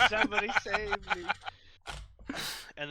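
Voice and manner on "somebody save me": higher, louder cry through laughter; laughing mock plea. Echoing co-host's impression, laughing mock plea. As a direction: repeat the plea while cracking up.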